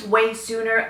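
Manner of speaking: hoarse voice giving out; voice cracking. Real, not acted. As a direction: let the voice crack on the words. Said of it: "I'm losing my voice".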